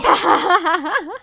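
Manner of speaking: high, loud cackling laugh; loud burst of laughter. Real, not acted. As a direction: burst out laughing in a long run. Laughs at chat comment.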